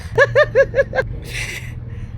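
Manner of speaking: high-pitched giggle; high laugh. Real, not acted. Haggling, grinning.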